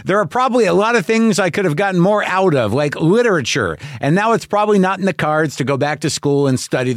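upbeat podcast ad voice; ad read. Acted. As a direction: sell it like a friendly sponsor spot. Reads a sponsor ad.

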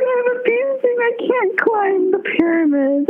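high, half-crying mock whine; hammed-up tearful complaint; whiny excuse. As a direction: plead like a kid dodging a chore. Whining excuse to get out of climbing.

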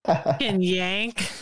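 tipsy, slow slurred jab. Real, not acted. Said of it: "I'm kinda tipsy".

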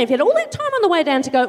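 usual speaking voice

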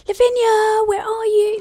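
put-on high child calling out; little boy calling for her.

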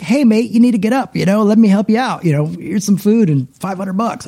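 lightly voiced, high, friendly British bloke; a hint of a cheery Englishman offering help; Ricky Gervais. Kind stranger helps homeless man, lightly imitated friendly British voice. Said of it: "He might have gone down and been like".

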